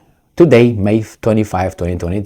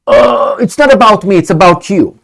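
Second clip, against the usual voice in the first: mock evasive protest; 8 semitones higher, 4 dB louder than usual. Mimicking the United States dodging his question, mock defensive. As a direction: dodge the question in a higher mocking voice.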